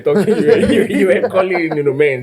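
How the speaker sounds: laughing teasing. Real, not acted. Cohost teasing the host about his accent, laughing. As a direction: tease him while cracking up.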